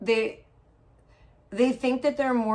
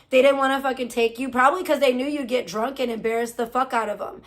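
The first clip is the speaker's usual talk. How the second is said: ordinary talk, nothing marked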